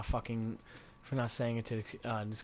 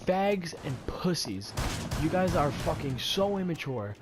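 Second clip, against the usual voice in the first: heated, higher-pitched denunciation; angry replay, raised but not all-out; retold screaming; 6 semitones higher, 3 dB louder than usual. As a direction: hammer out insults in a mock yell. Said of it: "I'm screaming at this kid".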